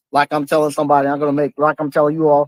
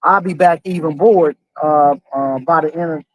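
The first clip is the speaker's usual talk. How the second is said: ordinary talk, nothing marked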